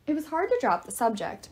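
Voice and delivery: ordinary delivery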